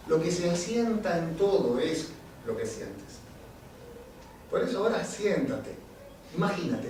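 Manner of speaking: measured spoken-word poem with deliberate pauses; poem recitation. Acted. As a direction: declaim each play on words to the audience.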